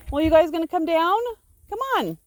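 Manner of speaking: high, loud and quick call; calling the sheep. Real, not acted. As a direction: shout up to coax them over.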